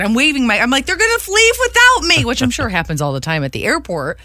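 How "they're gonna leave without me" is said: high frantic cry; panicking about missing the plane; panicked. Retold or imitated. Panicked rushing to gate.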